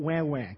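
put-on wah wah said as a nasal childish wail; mock crying. Shown as a demonstration. Parents telling kids not to whine, mock crying wah wah. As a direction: mimic a whining kid: wah wah. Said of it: "Can I say wah wah?".

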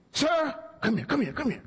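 high, carrying call to a man across the aisle; calling someone over. Retold or imitated.

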